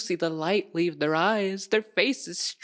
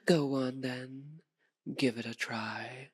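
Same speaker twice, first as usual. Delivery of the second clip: low, soft, unworried challenge; daring taunt; 5 semitones lower, 5 dB quieter than usual. Acted. Vampire daring hunter to strike, quiet taunt. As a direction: dare them quietly.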